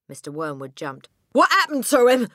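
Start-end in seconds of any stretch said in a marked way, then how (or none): 1.3-2.3 loud, high spluttered question; spluttering. A narrator's reading. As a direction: blurt it out in agitation. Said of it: "he spluttered".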